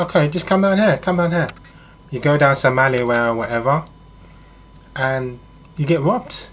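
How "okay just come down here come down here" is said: luring woman. Acted. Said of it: "She said".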